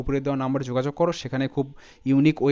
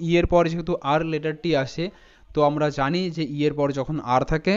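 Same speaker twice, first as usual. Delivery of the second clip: ordinary delivery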